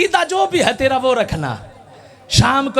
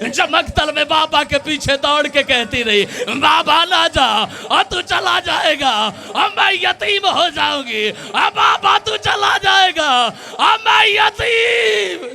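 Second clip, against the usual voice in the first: wailing lament; 5 semitones higher than usual. Acted. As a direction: stretch each cry as the weeping daughter. Daughter's plea, rising wail. (धीमी, ऊँची, ज़ोरदार रोती हुई पुकार)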